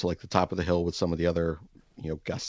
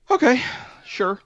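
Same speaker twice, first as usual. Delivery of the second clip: mock eager okay; 6 dB louder, slower than usual. Acting out agreeing to odd instructions.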